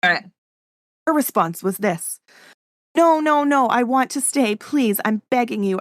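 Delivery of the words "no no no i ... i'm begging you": high, loud begging voice, hammed-up; mock pleading. Reading the houseguest's begging reply to her hosts, mock frantic pleading. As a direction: plead like a panicked houseguest, overacted.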